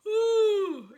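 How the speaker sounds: loud high yawning ooh; yawn sigh. Shown as a demonstration. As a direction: open the throat and yawn. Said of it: "practice that sound".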